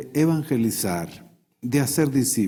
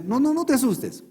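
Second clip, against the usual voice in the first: high-pitched "don't be scared"; acted hasty reassurance; 11 semitones higher, faster than usual. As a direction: play soothing someone startled, voice up high. Dios calma a Moisés asustado. (tranquilizar con voz aguda, actuado)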